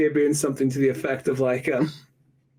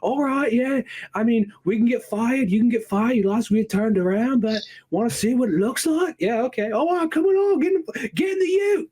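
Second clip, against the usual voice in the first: high-pitched, casual Aussie voice; broad Australian dude, climbing higher as he goes; 9 semitones higher than usual. Acted. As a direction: play an easygoing Aussie mate. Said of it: "like, Aussie dudes possible".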